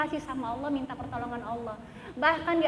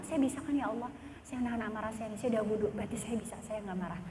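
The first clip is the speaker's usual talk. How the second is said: low, soft self-assuring prayer; quietly convince yourself before God; telling Allah; 4 semitones lower, 7 dB quieter, faster than usual. Shown as a demonstration. Believer tells Allah she can hold her anger, hushed and low. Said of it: "Langsung bilang sama Allah".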